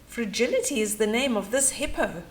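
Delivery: plain reading voice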